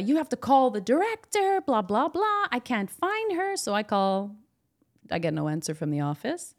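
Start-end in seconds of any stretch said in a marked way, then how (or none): 0.0-3.6 high-pitched mock demands; rude demanding woman. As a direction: imitate a rude, pushy visitor in a higher voice. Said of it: "but really rudely".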